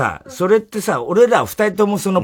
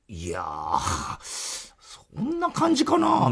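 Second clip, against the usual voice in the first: slow, drawn-out doubtful drawl; mocking partner's hedge; 6 semitones lower, 8 dB quieter, much slower than usual. Mocking a partner's vague reaction, drawn out. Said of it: "みたいな、お前が言うな".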